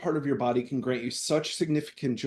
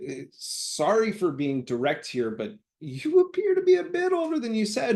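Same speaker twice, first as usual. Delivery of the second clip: awkward polite voice; 9 semitones higher than usual. Retold or imitated. Polite awkward confrontation quote.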